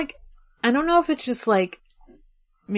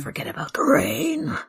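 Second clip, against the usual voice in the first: eerie whispered line; 5 semitones higher than usual. Pretend. Whispered lyric.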